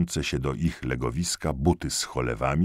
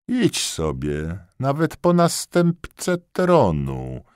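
mocking dismissal; 10 semitones higher, 3 dB louder, slower than usual. A narrator's reading. Kania mocking the corporal.

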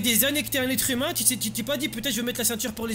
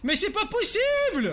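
high, slow, fed-up cry; exasperated shout; 11 semitones higher, 4 dB louder, slower than usual. Acted. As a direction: throw up your hands and yell it. Exasperated at NPC not buckling.

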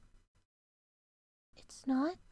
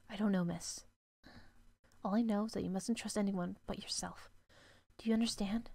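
lower, softer servant voice warning; grave maid; 5 semitones lower, 6 dB quieter than usual. Acted. Servant warning the girl to trust no one, low and soft. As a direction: warn her quietly like a guarded housekeeper.

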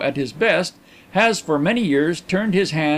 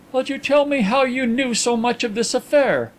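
higher-pitched genial Englishman; reply to a friend in a raised voice; lightly voiced gentleman; 8 semitones higher than usual. Narrator voices Carlyle bargaining.

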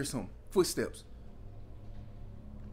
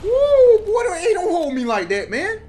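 high, loud yelling with a laugh; startled yell; 13 dB louder, slower than usual. Real, not acted. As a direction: holler as you get grabbed. Grabbed by enemy, yelling.

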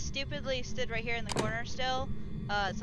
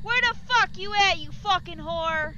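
loud, higher taunting yell; shouting; 8 semitones higher, 8 dB louder than usual. Acted. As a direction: scream insults to provoke her. Yelling to provoke the ghost.

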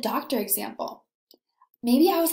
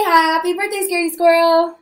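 cheery model line; 4 semitones higher, 6 dB louder than usual. Shown as a demonstration.

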